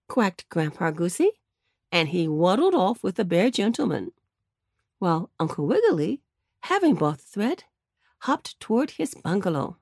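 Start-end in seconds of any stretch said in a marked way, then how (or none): none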